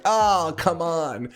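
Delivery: high, drawn-out mock groan of protest; exasperated whine. Runner protesting the forest route, in a drawn-out exasperated whine.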